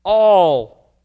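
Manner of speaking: "All" punched out loud on its own; a lone, forceful "All"; stress on ALL. Shown as a demonstration. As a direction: hit "All" hard as a single word. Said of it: "The emphasis is on the word all".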